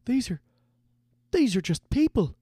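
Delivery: put-on high, faltering boy's plea; protesting young recruit. A narrator's reading. Recruit pleads for the captives, high and protesting. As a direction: plead haltingly, pitched well up. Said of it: "Hap protested".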